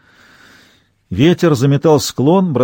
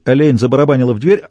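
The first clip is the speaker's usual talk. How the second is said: ordinary delivery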